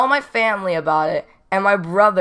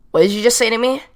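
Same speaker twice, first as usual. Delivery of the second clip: mock confrontational challenge, pitched up and louder; dead serious; 4 semitones higher, 5 dB louder, faster than usual. Classmate confronting the teller over an overheard ew, dead serious. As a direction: imitate an offended classmate demanding an answer. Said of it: "like, dead serious".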